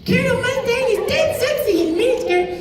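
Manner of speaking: sweet little brother delivering the line; Alphonse voice. Retold or imitated. Thirteen-year-old Aaron performing for kids, high boyish Alphonse voice. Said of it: "in his Alphonse voice".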